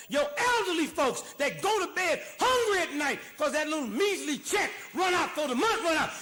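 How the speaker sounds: furious preacher yelling at the government; loud, high, outraged tirade; angry shouting rant. Real, not acted. Ranting at government spending priorities.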